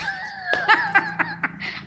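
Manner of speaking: high, bright laugh bursting out; amused laugh at the student's answer; real burst of laughter. Real, not acted. Amused by seven reais.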